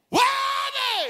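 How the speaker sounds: very high, stretched wailing demand; yelling at God. Retold or imitated. Preacher re-enacting a prayer demanding lost sons, yelling. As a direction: wail the question out, re-enacting the outburst. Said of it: "I was yelling at him".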